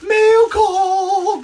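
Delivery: high, loud announcer call; excited call. Real, not acted. Host announcing mail call to viewers, high and loud.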